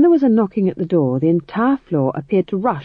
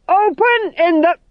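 shouted again; 12 semitones higher, 6 dB louder, slower than usual. Acted. Sergeant shouts again, cut off.

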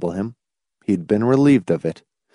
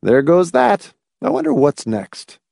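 mock carelessness; 7 semitones higher than usual. A narrator's reading. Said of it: "with mock carelessness".

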